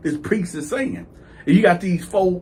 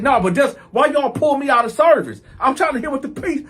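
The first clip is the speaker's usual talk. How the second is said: higher-pitched, aggrieved complaint; mildly indignant protest; 7 semitones higher than usual. Retold or imitated. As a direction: demand an explanation in a raised pitch. Retold protest at being pulled out.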